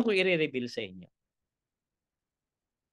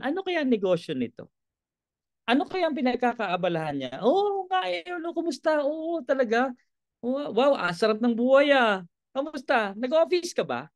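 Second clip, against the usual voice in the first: acted friendly chat; 6 semitones higher than usual. Voiced prospecting small-talk with friend.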